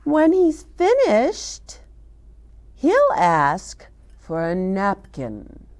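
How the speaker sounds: gentle storybook reading to little ones; reading to children. Acted. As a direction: read it out slowly like a picture book. Reads picture book to children.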